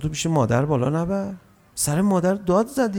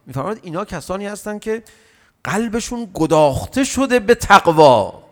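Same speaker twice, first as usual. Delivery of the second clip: ordinary delivery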